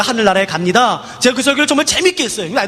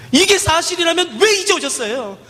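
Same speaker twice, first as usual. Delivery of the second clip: put-on much higher, slow, pleading child voice; child's anguished plea; 8 semitones higher than usual. Girl grabs the preacher and pleads, higher and anguished. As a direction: ask why he came so late, hurting.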